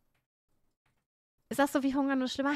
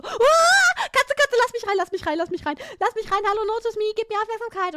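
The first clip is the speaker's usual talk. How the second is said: high, loud cat wail; panische Katze; 8 semitones higher, 8 dB louder than usual. Acted. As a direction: plead frantically to be let in, opening on a shriek. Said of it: "die Katze ist so". (hoher, lauter, flehender Katzenjammer)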